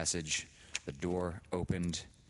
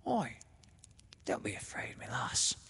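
fond and sly; 7 semitones higher than usual. A narrator's reading. Sister coaxes Laura at the bed, fond sly whisper. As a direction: hush her fondly, slyly. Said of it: "voice sounded fond and sly".

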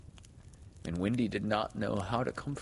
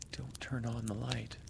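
hushed, near-whispered plea; frightened plea; 2 semitones higher, 7 dB quieter than usual. A narrator's reading. Peter, afraid of seeing, begs Wendy in a hush. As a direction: plead fearfully under the breath. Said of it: "he cried".

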